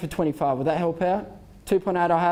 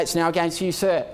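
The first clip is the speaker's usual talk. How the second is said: usual speaking voice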